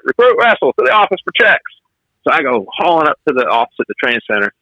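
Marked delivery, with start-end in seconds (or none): 0.0-1.6 calling out. Retold or imitated. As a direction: summon the recruit like a drill captain. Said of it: "so he's like".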